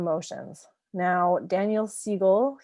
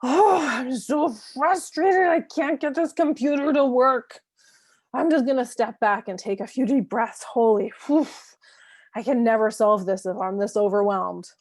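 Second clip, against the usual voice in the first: frustrated parent talking aloud; 4 semitones higher, 6 dB louder than usual. Shown as a demonstration. Parent venting within the child's earshot, frustrated, then calming. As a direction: vent at the computer, then talk yourself down. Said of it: "So something like".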